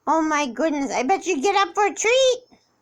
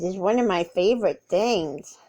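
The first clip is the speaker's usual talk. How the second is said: a touch lower, fond cat voice; 11 semitones lower than usual. Pretend. Owner voicing her cat about food, lower. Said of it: "He says".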